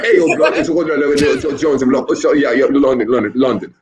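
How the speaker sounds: slow, hammed-up British bro voice, drawn out; mock London lad, half laughing; mock British guy. Imitating the clip guy to friends, a hammed-up London voice. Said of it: "walking around like".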